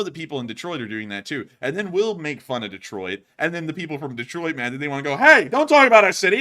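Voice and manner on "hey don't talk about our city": high, loud indignant retort; mock defensive outburst. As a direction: imitate a touchy local shouting back in protest. Mock defensive Detroit locals' outburst.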